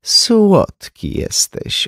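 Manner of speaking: high, very slow bored drawl. A narrator's reading. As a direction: brush him off, utterly bored. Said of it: "znudzonym tonem". (znudzona, przeciągnięta odpowiedź)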